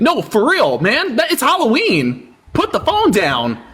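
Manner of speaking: high, exasperated telling-off; incredulous scolding. Real, not acted. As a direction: rant at the reckless driver. Scolding a driving caller.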